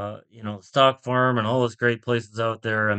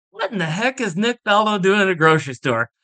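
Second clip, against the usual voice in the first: amused disbelief; 7 semitones higher than usual. Retold or imitated. Amazed at Faldo shopping.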